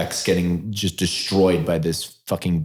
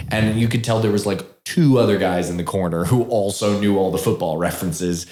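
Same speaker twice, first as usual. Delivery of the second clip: normal talking voice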